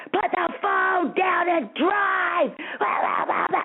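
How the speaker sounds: high, drawn-out imitation of a screamed order; mock screaming. Police officer ordering a driver off the phone, mock screaming. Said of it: "he starts screaming at me".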